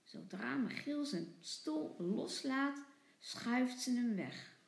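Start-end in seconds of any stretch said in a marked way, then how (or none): none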